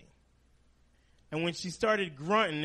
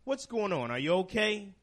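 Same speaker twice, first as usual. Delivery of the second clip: worried son; 6 semitones higher, 4 dB louder than usual. Retold or imitated. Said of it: "And I said".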